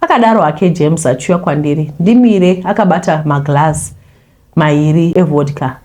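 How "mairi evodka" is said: ordinary delivery, nothing marked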